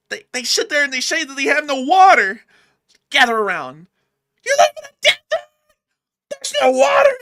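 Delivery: screaming comic, very high and loud; Sam Kinison. Retold or imitated. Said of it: "like Sam Kinison".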